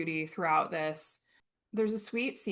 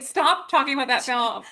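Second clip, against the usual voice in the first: loud, raised-pitch acted outburst; mock scolding; 6 semitones higher, 9 dB louder than usual. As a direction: blurt it out as an exasperated order. Acted scolding in retold scene.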